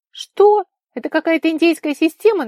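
high, loud, indignant disbelief; incredulous challenge. A narrator's reading. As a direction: question it loudly, high with disbelief. Vika frowns, incredulous in quarrel. (возмущённое недоумение, громко и высоко)

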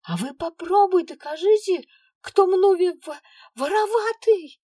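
very high, loud sulky blurt. A narrator's reading. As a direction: spit it out sulking. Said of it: "выпалила девчонка, насупившись".

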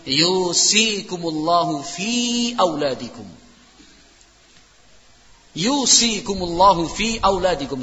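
slow, measured recitation with drawn-out vowels; Quran recitation. Real, not acted. Preacher quoting scripture to parents, reciting solemnly. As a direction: recite the verse slowly, stretching the long syllables.

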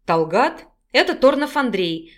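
plain reading voice

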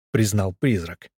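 ordinary delivery

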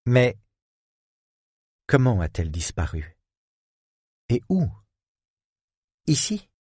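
put-on high, anxious young woman. A narrator's reading. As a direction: press him with worried questions.

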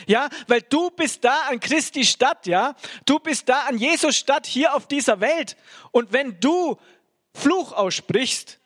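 rising, insistent preacher building to a peak; fervent preaching. Acted. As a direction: drive the message home like a revival preacher. Preaching builds to full force.

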